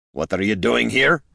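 gruff, mildly growled question; grumbling, understated irritation. A narrator's reading. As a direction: growl it like a grumpy dwarf. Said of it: "Gorm growled".

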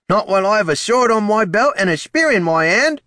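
high, loud proud vow; defiant soldier. A narrator's reading. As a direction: swear it with pride.

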